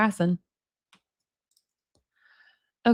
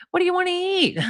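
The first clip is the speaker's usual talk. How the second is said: sudden casual; 8 semitones higher, faster than usual. Retold or imitated. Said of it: "and then all of a sudden just".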